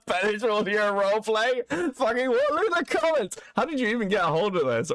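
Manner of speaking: high, incredulous shock; can't believe what he's seeing; shocked disbelief. Real, not acted. Shocked by roleplay clip.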